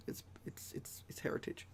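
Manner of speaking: breathy unvoiced whisper, half joking; whispered aside. Joking to viewers about his Scottish dad, whispered. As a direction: lean in and whisper it like a secret.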